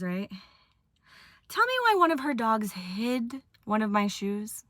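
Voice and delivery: ordinary talk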